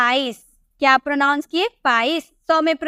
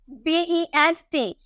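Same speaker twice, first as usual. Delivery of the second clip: पढ़ाते हुए हर अक्षर अलग-अलग; slow spelling out; 3 dB quieter, much slower than usual. Shown as a demonstration. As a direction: spell बी ई एस टी slowly, letter by letter. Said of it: "spelled letter by letter". (धीरे-धीरे अक्षर बोलते हुए)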